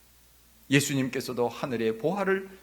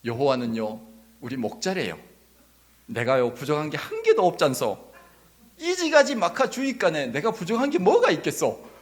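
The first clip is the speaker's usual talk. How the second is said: Gangwon dialect impression, building louder; 2 semitones higher, 6 dB louder than usual. Shown as a demonstration. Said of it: "강원도 버전". (점점 높고 커지는 강원도 사투리 흉내)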